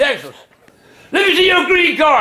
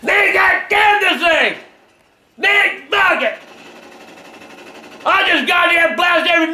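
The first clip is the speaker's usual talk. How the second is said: furious shouting. Real, not acted. Raging at the video game.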